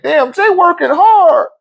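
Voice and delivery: high, slow pitying mock voice; a hammed-up sympathetic wife. Mocking wife's sympathy.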